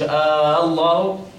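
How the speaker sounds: slow "آه الله" with a long opening aa; added alif. Shown as a demonstration. Teacher recalling the earlier error, stretched hamza. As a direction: hold the first vowel of "آه الله" too long. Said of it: "ما تقولش".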